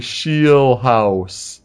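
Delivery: Scheelhaase stretched slowly as SHEEEL-house; demonstrated pronunciation. Shown as a demonstration. Host sounding out a quarterback's weird name, Scheelhaase slowly as SHEEL-house. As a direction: draw out both halves of Scheelhaase, "sheel" then "house". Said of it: "And it's like".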